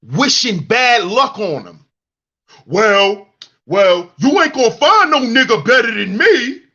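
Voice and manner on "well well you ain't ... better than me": louder, higher whiny mock wail; mocking men boasting no one beats them; mocking bitter man. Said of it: "You sound like a woman".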